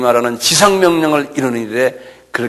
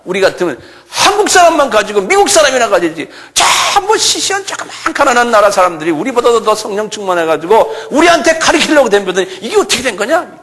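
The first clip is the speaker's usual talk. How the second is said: mock indignant pride; 8 semitones higher than usual. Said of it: "똑같은 소리".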